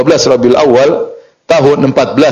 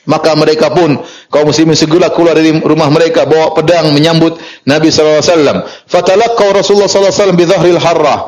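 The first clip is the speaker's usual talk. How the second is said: normal talking voice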